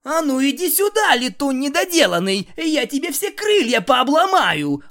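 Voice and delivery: roaring threat, loud and high; furious shout. Acted. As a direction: yell it with full fury. Luna charges at Starscream.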